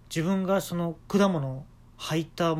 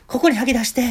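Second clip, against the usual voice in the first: high, loud instruction; bossy helper voice; lady helper; 9 semitones higher, 10 dB louder than usual. Retold or imitated. Wife in volleyball kit instructs. (バケツを持った奥様が高く大きな声で指示)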